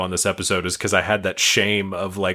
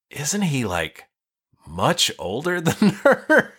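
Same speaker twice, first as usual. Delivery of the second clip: wife's surprise; 7 semitones higher, slower than usual. Retold or imitated. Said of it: "she was like".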